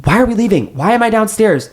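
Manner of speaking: very high, panicked questions; hysterical. A narrator's reading. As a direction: pitch it up in bewildered panic. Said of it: "She's hysterical".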